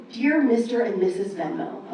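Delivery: ordinary talk, nothing marked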